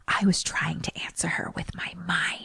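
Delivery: hushed aside to his wife, turning plaintive; whispered complaint. Retold or imitated.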